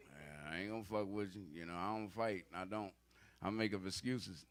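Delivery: low, soft, sheepish excuses; meek backing down. Retold or imitated. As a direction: talk your way out of a fight timidly. Voicing himself backing down.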